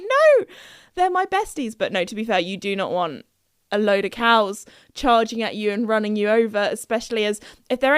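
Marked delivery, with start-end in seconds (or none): 0.0-1.8 high protest. Retold or imitated. Protesting dad shooing cows.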